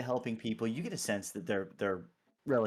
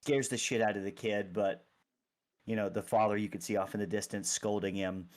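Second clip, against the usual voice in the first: normal talking voice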